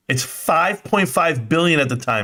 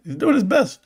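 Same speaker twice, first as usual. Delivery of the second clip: sarcastic higher mock of Adam Aaron; 5 semitones higher than usual. Streamer mocking the CEO's excuse, sarcastic higher pitch. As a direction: sneer his excuse back, pitched up. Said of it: "Kinda like Adam Aaron".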